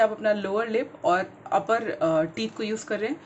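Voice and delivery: ordinary talk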